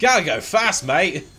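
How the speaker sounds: high, loud British cartoon hero; Sonic. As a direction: play a very British speedy mascot. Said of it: "like, a British Sonic".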